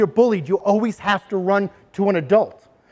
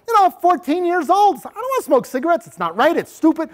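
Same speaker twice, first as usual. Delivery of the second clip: put-on teen voice; 9 semitones higher than usual. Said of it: "playing a pressured teen".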